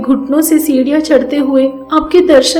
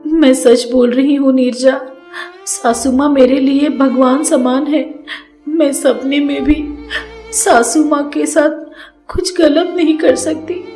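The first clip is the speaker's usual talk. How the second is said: higher, earnest tearful plea; daughter-in-law weeping to her sister-in-law, tearful; 4 semitones higher than usual. Acted. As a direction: plead through tears for belief. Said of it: "गले लगाकर रोते रोते बोलती है".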